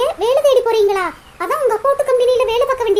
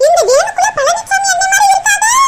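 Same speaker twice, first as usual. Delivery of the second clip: shocked; 9 semitones higher, 4 dB louder than usual. Acted. Woman shocked seeing groom's van.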